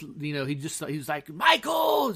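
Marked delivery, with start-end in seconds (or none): 1.3-2.1 much higher and louder yelled name; retold scream. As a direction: bellow the name like an angry neighbour. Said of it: "and then screams".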